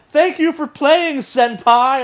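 high, loud anime squeal; excited anime fan. Retold or imitated. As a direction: gush it like an anime girl. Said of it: "It's like".

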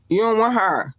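You don't want her pitched high on want; high emphatic warning. Shown as a demonstration. Warning listeners off the mean one, high and pointed. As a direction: lift the voice on want.

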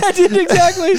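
high laughing agreement. Real, not acted. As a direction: agree while laughing hard.

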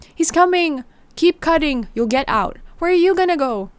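put-on high anxious child voice; frightened urgency. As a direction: rush it out in fear. Urgent whispered panic to Darby.

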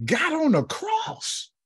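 stretched high sermon cry; preacher's high, slow climax. Real, not acted. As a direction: pull it out long and high like a wail.

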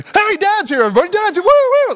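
very high, whooping party-dad call; mock excited entrance. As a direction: burst in hollering and whooping. Imagined dad bursting in on kids.

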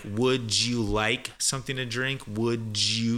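"Would you" blended into "wudjoo"; slow demo of "Would you" as "wud-joo"; linked D and Y. Shown as a demonstration. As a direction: slowly join "Would you" with a J sound. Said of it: "when you have this D and the Y".

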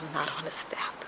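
barely voiced, ashamed whisper; whispered confession. Answering the sponsor through tears, in a whisper. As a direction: breathe it out in shame. Admitting it while crying.

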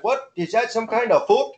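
comic Canadian oo on the last word; mock Canadian accent. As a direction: imitate a baffled Canadian, voice lifted. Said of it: "you can't tell the difference between fact and fuck".

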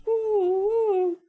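high, loud mouth siren; siren imitation. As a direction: wail like an ambulance siren.

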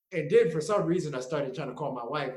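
ordinary delivery

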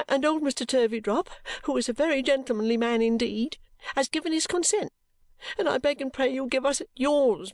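high-pitched tearful pleading; sobbing. A narrator's reading. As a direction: beg mother through acted sobs. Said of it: "sobbed Caddy".

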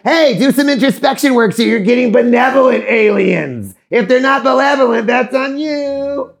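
high, loud call-out; mock scolding. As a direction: holler a cheeky warning, drawing out the ending. Speaker acts out scolding admonishment.

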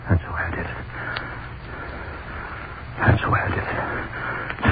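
low, unvoiced murmur; hushed aftermath. Hangman alone after escaping the mob, hushed. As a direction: drop to a spent near-whisper.

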